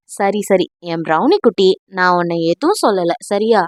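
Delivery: put-on calm her like a child; gentle reassuring murmur; soothing. Soothing his drunk wife.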